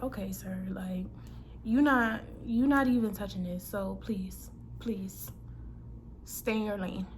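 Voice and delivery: very soft, low, half-whispered brush-off; hushed, low-pitched retort; muttered warning. Retold or imitated. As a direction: quietly tell him to back off under your breath. Muttered retort to pushy man.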